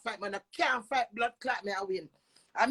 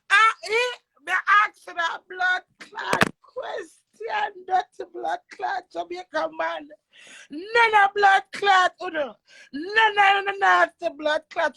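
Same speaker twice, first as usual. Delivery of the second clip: higher and louder, slow, crying out; tearful angry shout; 6 semitones higher, 6 dB louder than usual. Real, not acted. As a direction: cry out in rage. Rant peaks in anger.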